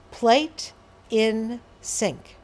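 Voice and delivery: very slow, word by word; fewest words. Shown as a demonstration. Trainer giving a simple instruction to someone escalating, slow and spaced. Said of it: "you might say".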